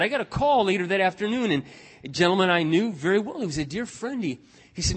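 ordinary talk

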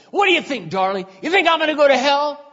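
loud, high sneering taunt. Retold or imitated. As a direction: needle him loudly in front of everyone. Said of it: "He goes".

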